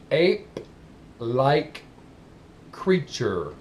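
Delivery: extremely drawn-out, separated words; slow and clear. Shown as a demonstration. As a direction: repeat it painstakingly, one word at a time. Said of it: "I'm gonna say it slow and as clear as I can".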